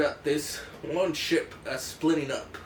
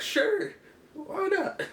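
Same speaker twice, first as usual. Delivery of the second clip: squeaky, very high put-on reply; high-pitched mock agreement; much slower than usual. Others humour the Frenchman's request.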